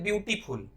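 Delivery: ब्यूटीफुल run together as a learner's mistake; common mispronunciation. Shown as a demonstration. Teacher showing how many people say it, mispronounced. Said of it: "गलत pronounce करते हैं".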